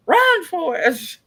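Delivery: high, loud, drawn-out yell; mock warning cry. [name] warning Matt about the singing cook, mock alarm. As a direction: call it out long and high.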